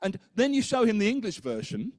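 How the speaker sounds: ordinary delivery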